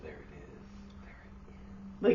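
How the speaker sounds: slow whispered amazement; soft, drawn-out wonder; hushed awe. Retold or imitated. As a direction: whisper it like spotting a long-lost dream. Retelling awed sighting from the car.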